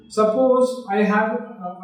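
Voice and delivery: ordinary delivery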